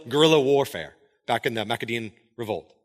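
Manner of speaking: usual speaking voice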